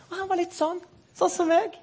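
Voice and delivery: high, soft little voice; meek Jakob voice. Acted. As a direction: go small and high. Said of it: "det var Jakob".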